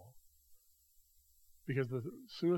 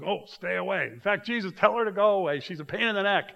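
higher, louder mock complaint, pushing her away; a hammed-up irritated disciple; annoyed disciples; 7 semitones higher, 8 dB louder than usual. Disciples complaining to Jesus about her, a mock loud whine.

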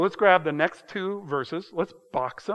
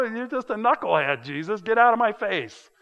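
mock scorn; 5 semitones higher, 3 dB louder than usual. Mock accuser dismissing Jesus.

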